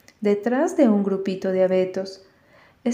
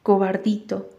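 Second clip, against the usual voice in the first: ordinary delivery, nothing marked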